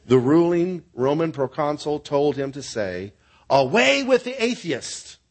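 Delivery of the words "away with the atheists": high, drawn-out proclamation; pitched up high and held, slowly; declaimed denunciation. Acted. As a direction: declaim it like an official decree. Proconsul's demanded denunciation, acted out.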